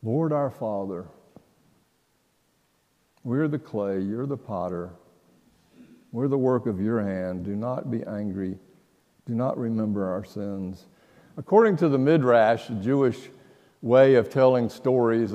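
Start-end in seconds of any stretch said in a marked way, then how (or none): 0.0-10.9 much softer, lower, the verse turned into a prayer; hushed prayerful repeat. Shown as a demonstration. Preacher softly repeating the verse as a prayer, hushed. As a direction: murmur it back to God like a private prayer. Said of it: "same verse repeated as prayer".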